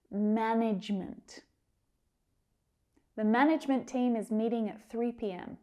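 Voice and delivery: usual speaking voice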